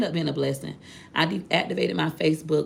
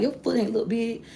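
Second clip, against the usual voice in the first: reassuring friend; 7 semitones higher than usual. Retold or imitated. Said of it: "Wood was like".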